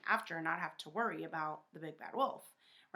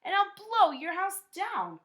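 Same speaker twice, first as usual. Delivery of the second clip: loud, rising to a high threatening cry; wolf threatening to blow the house down, louder and higher; wolf's loud threat; 7 semitones higher, 8 dB louder than usual. A narrator's reading. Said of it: "cried the wolf".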